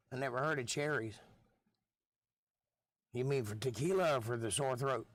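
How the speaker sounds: usual speaking voice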